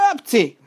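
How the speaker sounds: loud high acted sneeze; explosive mock sneeze. A narrator's reading. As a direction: burst out with a short sneeze. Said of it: "A tüsszentés közben".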